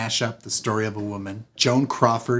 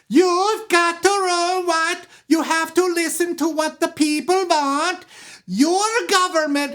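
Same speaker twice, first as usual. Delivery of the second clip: high, slow, stilted oratory; yelled speech. Retold or imitated. Katie yelling her campaign speech at the crowd, high and slow. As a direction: declaim at the crowd stiffly. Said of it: "don't try to yell at them like that".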